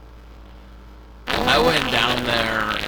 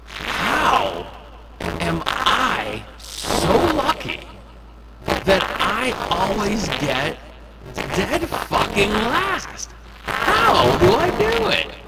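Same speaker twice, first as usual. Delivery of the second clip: high mock-amazed sarcastic whine; sarcastic disbelief; 10 semitones higher, slower than usual. Real, not acted. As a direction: pitch it up in fake wonder. Mock amazement at bad luck.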